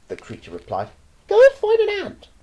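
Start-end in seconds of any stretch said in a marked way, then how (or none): 1.3-2.3 put-on high, loud boy's shout. Roy shouts at the starving anteater, bratty. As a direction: yell it down as a bratty boy. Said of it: "the boy replied".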